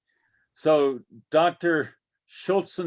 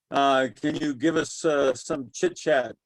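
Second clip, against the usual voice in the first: normal talking voice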